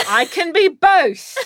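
put-on slow, high, proud English-accented kid. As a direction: drag it out in a fake English accent. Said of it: "fall into an English accent".

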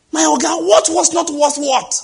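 high, loud sing-song protest; exasperated cry. Real, not acted. Preacher crying out high in disbelief at the man's claim.

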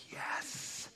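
hushed whisper of relief; whispered yes. Retold or imitated. Moses whispers his relief to God. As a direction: breathe it out in a whisper. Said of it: "And Moses is like".

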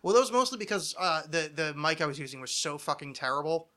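normal talking voice

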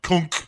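short, high, loud impact noise; imitated knockout thunk. Imitating Bond being knocked out, loud sound effect. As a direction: pop out a mock blow. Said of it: "They're like".

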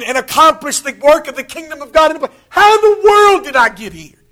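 climbing from steady to loud, high exclamation; building preacher's wonder. Acted. Preacher marvelling at his own calling, building to a loud cry.

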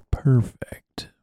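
breathy whisper on "perfect."; whispered word. Acted. Stresses wish for perfect sound.

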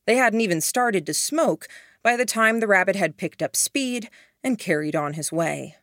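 usual speaking voice

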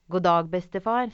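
calling out a bright hello; loud, friendly greeting; cheerful loud greeting. Acted. Boy greets the troll. (høy, glad hilsen)

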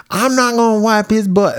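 mock outraged wife, pitched high; high, protesting talk-show guest; indignant wife. Podcaster acts out a Jerry Springer wife refusing, high indignant voice.

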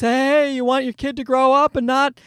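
put-on eager advice voice; parenting book voice. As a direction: pitch it like a chirpy self-help book. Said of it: "You know, the books".

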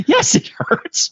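high, slow mock-pained whine; hammed-up hurt; pained retort. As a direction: drag it out in squeaky exasperation. Pained hero's answer retold.

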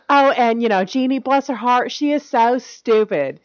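sweetly catty Southern woman; put-on Southern belle; Southern accent. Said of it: "from the South and they're like".

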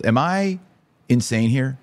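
usual speaking voice

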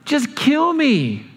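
slow, high, dragged-out cry; despairing outburst. Retold or imitated. Angry Jonah begging God to end it, wailing. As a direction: wail it to the sky. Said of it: "so angry".

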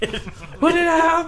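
high, loud laughing shout; laughing yell. Acted. GM yells laughing.

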